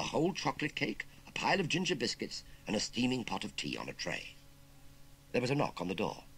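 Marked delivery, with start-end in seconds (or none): none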